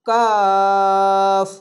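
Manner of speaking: কাফ with the a held very long and steady; stretched three alif. Shown as a demonstration. Teacher demonstrating the long three-alif vowel to students. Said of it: "এখানেও তিন আলিফ টেনে পড়বেন।".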